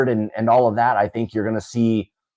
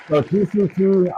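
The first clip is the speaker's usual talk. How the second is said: hyped giveaway announcement; 9 semitones higher than usual. Real, not acted.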